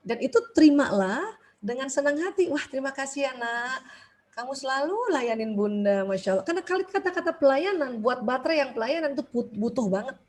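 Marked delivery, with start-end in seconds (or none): 2.5-6.5 soft, much quieter grateful mum; tender thanks. Retold or imitated. Mother softly thanking her helpful child, tender and quiet.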